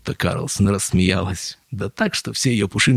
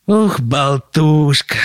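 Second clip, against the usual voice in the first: hurt, slow exclamation; offended; 7 semitones higher, 8 dB louder, much slower than usual. A narrator's reading. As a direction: sound wounded and huffy. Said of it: "обиделся Людвиг четырнадцатый".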